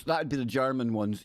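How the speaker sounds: ordinary talk, nothing marked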